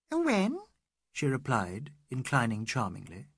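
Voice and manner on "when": Mrs Bhattacharya voiced much higher, rising; a sudden bright high question; high eager when. A narrator's reading. Mrs Bhattacharya answering Mrs Moore, high and rising.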